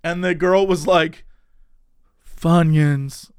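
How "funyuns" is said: lower, odd-timbred weird voice; crazy voice. Retold or imitated. Dazed girl ordering chips, weird lower voice. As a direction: say it like she's out of it. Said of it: "In, like, the craziest voice".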